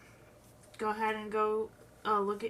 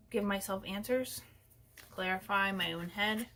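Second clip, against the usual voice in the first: usual speaking voice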